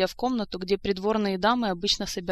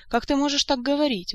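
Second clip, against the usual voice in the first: higher, louder indignant reproach; acted indignation, pitched up; 8 semitones higher, 5 dB louder than usual. A narrator's reading. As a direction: protest as if scandalised. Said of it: "возмутилась Азиль".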